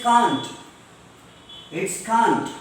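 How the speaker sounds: CAN'T with a long open "ah"; broad British "kaant" for CAN'T. Shown as a demonstration. Teacher modelling British English for class, CAN'T as "kaant". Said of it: "In British English".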